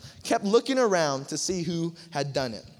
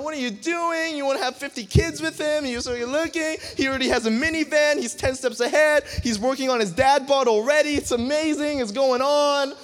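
put-on high, lively inner voice of a smitten girl; girl's giddy thoughts; 7 semitones higher, 5 dB louder than usual. The girl walking away, talking herself into him, lightly voiced excited. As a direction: gush to yourself, a touch higher. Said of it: "she's thinking to herself".

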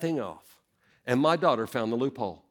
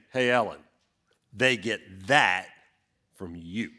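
pointed blame; 7 semitones lower than usual. Shown as a demonstration. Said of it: "isn't something that you would necessarily affirm".

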